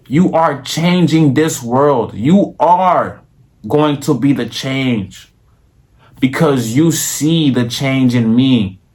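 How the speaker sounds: slow, drawn-out, mock-grand inspirational sermon; mock preacher plea. Vlogger to viewers, urging subscribes like a slow, grand mock sermon. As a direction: hype liking the video like saving the world.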